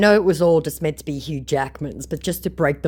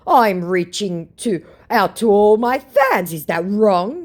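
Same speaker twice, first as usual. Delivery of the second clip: higher, louder, slow injured innocence; mock wounded scammer; 7 semitones higher, 7 dB louder, slower than usual. The scammer protesting he only reaches out, high and hurt. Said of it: "he says".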